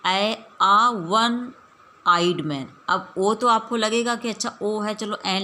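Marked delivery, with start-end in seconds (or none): 0.1-2.7 आ वन slowly and louder, वन starting with a w; emphatic demo. Shown as a demonstration. Teacher loudly models आ वन आइड मैन. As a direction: lean on आ वन आइड मैन, slow. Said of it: "pronounce करो तो कैसे आ रहा".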